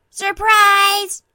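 loud, high party yell; surprise shout. Acted. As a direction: burst out as the lights come up. Party guests shout as lights come up.

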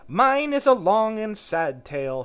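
high, loud, drawn-out lament; slow, theatrical mouse sigh of woe. A narrator's reading. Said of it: "turning to Alice and sighing".

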